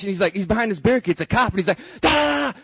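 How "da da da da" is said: mock cop yelling. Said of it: "yelling at me in Spanish".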